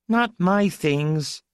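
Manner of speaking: put-on high, slow, insistent little-girl command; child giving orders. A narrator's reading. Small girl ordering the maid off her belongings, bossy. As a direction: lay down the law in a high child voice. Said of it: "Zida ordered".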